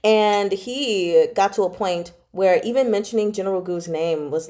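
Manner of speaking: normal talking voice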